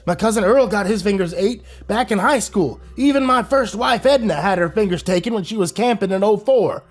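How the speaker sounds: put-on high old man shrugging it off; old captive explaining; matter-of-fact old man. A narrator's reading. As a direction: explain it like it's normal.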